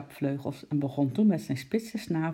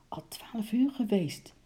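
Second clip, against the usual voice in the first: whispered; 7 semitones higher, slower than usual. A narrator's reading. Uncle checks his watch.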